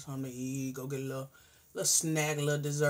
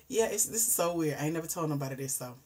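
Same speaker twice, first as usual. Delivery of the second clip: ordinary talk, nothing marked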